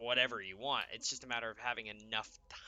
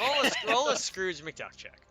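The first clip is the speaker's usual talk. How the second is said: excited echo; 10 semitones higher, 8 dB louder than usual. Real, not acted. DM echoes the joke.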